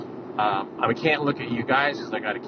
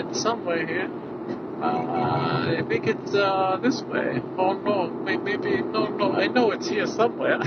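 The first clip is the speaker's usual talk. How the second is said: higher, puzzled searching voice; acting lost; 6 semitones higher than usual. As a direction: dither like you're lost. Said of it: "kinda like that".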